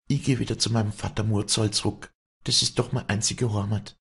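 high, quick dialect voice; Walli, resolved. Acted. Walli names her only home.

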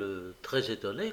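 ordinary delivery

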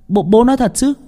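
put-on high eager child's voice; a little boy lighting up; excited boy. A narrator's reading. Excited boy asks father. (giọng cậu bé háo hức)

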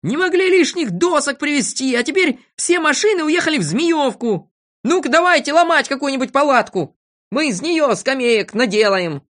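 high, loud, bossy rant; vexed shouting. A narrator's reading. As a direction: shout orders in frustration. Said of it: "кричал он".

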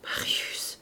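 breathless whispered call of the name; stunned grandfather's whisper; murmured name. A narrator's reading. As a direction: barely voice the name in shock. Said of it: "et il murmura".

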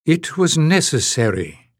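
plain reading voice

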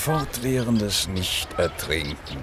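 slow pronouncement. Acted. Pronounced philosophical line.

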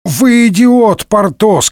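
high, slow furious yell; angry shout. A narrator's reading. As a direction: yell it at him in rage. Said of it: "кричал Д'Артаньян".

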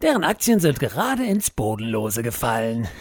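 normal talking voice

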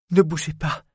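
high, pleading cry to stay still. A narrator's reading. Lorraine begs the dying sailor not to move, pleading and tearful. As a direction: beg him not to move, close to tears. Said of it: "le supplia Lorraine".

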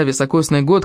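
usual speaking voice

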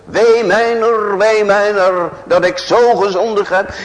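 loud, high wailing lament. Acted. Preacher voicing a repentant sinner's lament, loud and high. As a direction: wail the guilt out.

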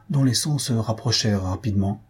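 plain reading voice

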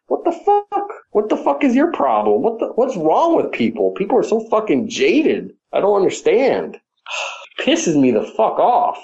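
high-pitched exasperated ranting; fed-up gamer venting about whiny fans; real angry rant. Real, not acted. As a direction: vent the frustration, pitch climbing. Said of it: "How do you really feel?".

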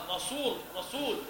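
رسول said twice with a heavy sad sound; mock emphatic sad. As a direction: push the s of رسول dark and thick. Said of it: "ينطق السين صادا". (تقليد تفخيم السين في رسول)

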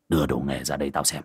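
low, quick, curt command; gruff low order. Acted. Sorcerer orders his helper to hand over tools, low and curt. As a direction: bark it low and fast at the helper.

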